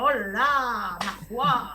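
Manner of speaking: a hint of a Marseille twang, played for laughs; southern French accent. Teasing the radio hosts in a mock southern French accent. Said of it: "je reprends mon accent du sud".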